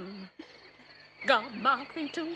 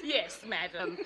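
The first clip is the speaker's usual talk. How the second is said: laughing; 5 semitones lower, 6 dB quieter than usual. Real, not acted. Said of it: "Yet I cannot choose but laugh".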